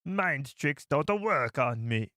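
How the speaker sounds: slow, lightly put-on alien accent; slow mock Watto accent; Watto impression. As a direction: drag it out like Watto. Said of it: "It's almost like a Jamaican accent".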